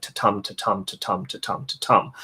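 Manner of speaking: teacher showing sonnet meter, stressing each tum; iambic rhythm demo. Shown as a demonstration. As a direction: chant the meter, weak to then strong tum. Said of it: "have in a sonnet".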